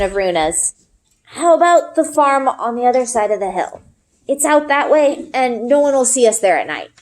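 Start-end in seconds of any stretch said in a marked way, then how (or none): 1.2-6.9 lightly voiced boy, a bit higher, urging his friend; a hint of an earnest boy pleading to be believed; Ari, eager boy. Ari's voice in read story.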